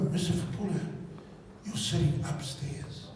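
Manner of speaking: ordinary talk, nothing marked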